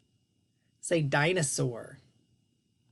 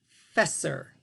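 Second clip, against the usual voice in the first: normal talking voice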